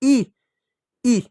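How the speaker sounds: brief, separate repeats of ई; short ई. Shown as a demonstration. Teacher repeating the short vowel for children, short ई. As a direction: say ई short, pausing between each. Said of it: "किस रूप में".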